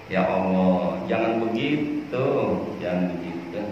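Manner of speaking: low, softening admonition; gentle pleading. Acted. Preacher urging people not to be harsh, gentle. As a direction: drop down and plead tenderly, fading off.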